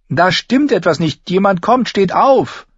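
high, loud alarm; pressing, agitated call to get up; urgent warning. A narrator's reading. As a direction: rouse everyone before danger arrives. Urging sleepers up before danger.

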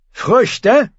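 high, mocking echo; scornful question. A narrator's reading. Euron scorning Red Ralf's proposal, high and mocking. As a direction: throw the word back with scorn.

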